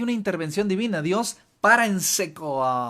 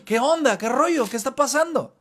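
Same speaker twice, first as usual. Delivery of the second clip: startled paraphrase; 7 semitones higher, 5 dB louder than usual. Acted. Paraphrasing Saulo's startled reaction.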